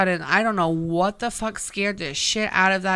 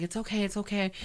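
softer, gentle it's okay to a frightened dog; soothing; 9 dB quieter, faster than usual. Retold or imitated. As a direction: hush and reassure the shaking pet. Comforting the scared dog.

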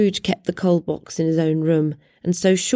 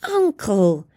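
pleading. A narrator's reading. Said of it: "pleaded the nephew".

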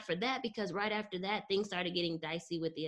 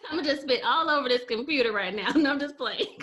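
laughing and joking, louder; 6 semitones higher, 6 dB louder than usual. Real, not acted. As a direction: crack up while joking.

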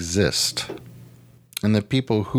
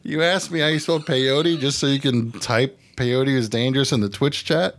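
incredulous teasing; 9 semitones higher than usual. Real, not acted. Teasing friend for typing in chat.

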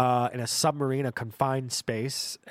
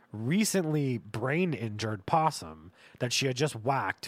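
ordinary talk

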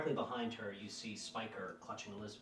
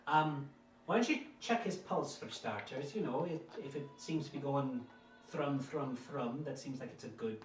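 motherly housekeeper; 5 semitones higher, 6 dB louder than usual. Acted. Lottie instructs the maid.

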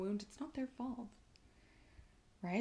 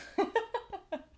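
laugh; 13 semitones higher, 3 dB louder than usual. Real, not acted. Laughing at her plan.

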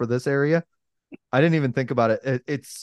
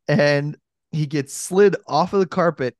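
ordinary delivery

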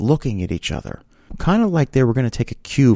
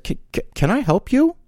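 high-pitched stammering disbelief, acted; baffled challenge; 13 semitones higher than usual. To stranger sitting on his car, acted high baffled stammer. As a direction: stumble over a polite but baffled question.

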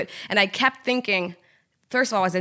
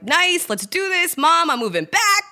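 pitched up, slow and happy; excited; 9 semitones higher than usual. Retold or imitated. Retelling her joy at a fifty K job, pitched up and slow. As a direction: savour the good news.

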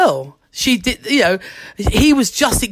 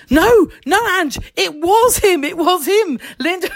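realising it really was him, higher and excited; excited disbelief; 8 semitones higher than usual. Retold or imitated. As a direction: blurt it out in thrilled disbelief. Realising George really phoned.

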